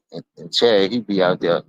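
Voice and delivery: ordinary talk, nothing marked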